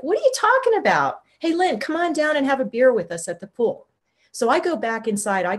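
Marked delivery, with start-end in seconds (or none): 0.0-3.9 high, puzzled call-out turning into an invitation; casual neighbors. Retold or imitated. Neighbors calling up from the pool, puzzled. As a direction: imitate oblivious neighbors calling up, pitched high.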